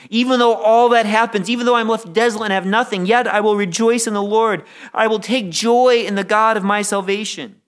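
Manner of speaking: swelling sermon fervor; fervent preaching. Real, not acted. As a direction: preach it out with conviction. Preacher declares rejoicing despite loss.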